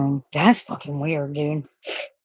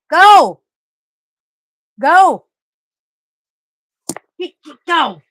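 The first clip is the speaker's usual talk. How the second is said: much higher, loud urging yells; shouting go; 11 semitones higher, 13 dB louder, much slower than usual. Real, not acted. Owner urging her dog to chase, loud high shouts. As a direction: fire him off like a starter.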